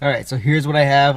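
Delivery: ordinary delivery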